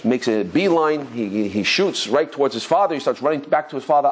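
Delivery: ordinary talk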